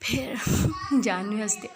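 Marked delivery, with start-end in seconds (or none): 0.4-1.0 laugh. Acted.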